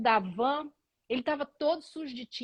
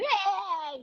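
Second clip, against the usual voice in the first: gemido de choro exagerado, agudo e esticado; choro imitado; 7 semitones higher than usual. Retold or imitated. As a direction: imite um berreiro de choro. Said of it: "e chora, ele". (choro fingido, subindo num lamento agudo)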